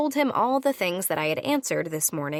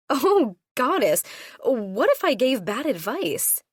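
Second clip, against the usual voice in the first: excited panic; 5 semitones higher, 4 dB louder than usual. A narrator's reading. Squealing panic after the news.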